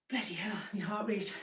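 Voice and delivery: winded, whispered under the breath; breathless whisper. Real, not acted. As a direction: gasp it out, spent. Breathless after the set.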